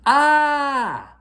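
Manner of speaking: very high, very loud shriek; all-out tantrum scream; loudest yell. A narrator's reading. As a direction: shriek with everything she has. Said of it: "the loudest yell possible".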